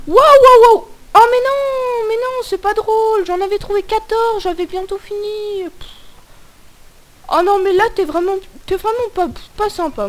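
loud high panicked shriek; scared horror game streamer. Real, not acted. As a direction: scream as the monster catches you. Caught by the monster.

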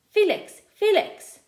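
loud, high call across the car park. A narrator's reading. Friend running up, calling Felix to stop. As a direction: shout his name to stop him.

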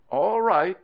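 loud, high, hasty reassurance; placating. Acted. Crocodile husband to his whimpering wife, placating.

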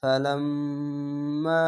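long nasal hum on the "ম্ম" of "ফালাম্মা"; very slow "ফালাম্মা", holding the doubled m, then the final vowel; obligatory ghunnah. Shown as a demonstration. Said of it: "এটা হচ্ছে ওয়াজিব গুন্না". ("ফালাম্মা"-র মিমে গুন্না করে টেনে পড়া)

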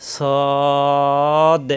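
"সদ" with a heavy, long-held vowel; articulation demo. Shown as a demonstration. Teacher shows where tongue meets teeth, drawn-out "সদ". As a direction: draw out "সদ" on one steady note. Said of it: "শুধু সদ উচ্চারণ করব".

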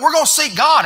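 high, loud declaration; rally the church behind it; shouted pulpit declaration; rallying cry. Real, not acted. Preacher's rallying answer.

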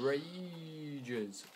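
stretched word swooping up high; drawn-out emphasis. Real, not acted. Gamer admitting his temper, playfully drawn-out. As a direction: draw it out in a rising, falling swoop. Jokes that he rages.

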